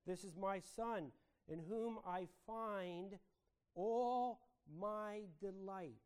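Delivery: slow, weighty proclamation with drawn-out words; stately quotation, building on the last words; voice of God the Father. Acted. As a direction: proclaim it slowly as a divine declaration. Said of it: "It is the voice of God the Father".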